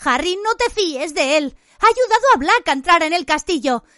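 high, alarmed, urgent denunciation; high-pitched accusation, slowing for the final reveal; shouted warning. A narrator's reading. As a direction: cry out a desperate warning to a friend. Hermione shouts a warning to Harry.

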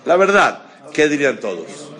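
emphatic call. Real, not acted. Teacher prods class for honesty.

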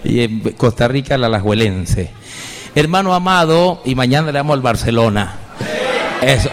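ordinary talk

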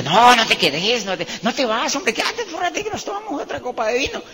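high-pitched, insistent coaxing to stay; acted-out urging, rising into a high pitch; pleading protest. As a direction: plead with a guest not to leave, voice climbing high. Acting out begging guest to stay.

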